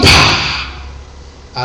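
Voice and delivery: explosive unvoiced "pah"; imitated impact burst. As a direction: blast out a sound effect of people knocked down. Imita el golpe de la caída. (estallido imitado con la boca)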